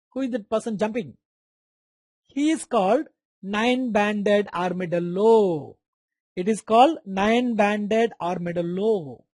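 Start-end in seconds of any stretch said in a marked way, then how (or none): 2.3-9.2 slow dictation. Shown as a demonstration. Dictating names slowly.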